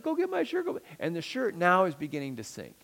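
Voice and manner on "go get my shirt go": put-on high, wailing little boy's cry; crying child. Little son crying to his dad for his lost shirt, high wailing. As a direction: whine it like a sobbing five-year-old. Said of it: "and he's like".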